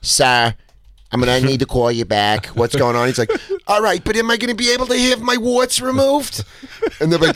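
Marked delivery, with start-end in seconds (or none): 0.0-3.1 nineties New York receptionist; brisk-business New York accent. Retold or imitated. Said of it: "it's like nineties New York". 3.7-6.4 very high, pleading character voice; high-pitched prank caller; Saul Rosenberg. Retold or imitated. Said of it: "his first character is Saul Rosenberg".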